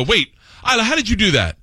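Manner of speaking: high-pitched, startled questioning; baffled dad. Acted. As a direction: act out a dad caught off guard. Startled dad confronting his daughter.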